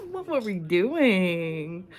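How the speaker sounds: laughing through a slow, drawn-out question. Real, not acted. Laughing at her teenage self while telling viewers, drawn out. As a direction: drag the words out while laughing.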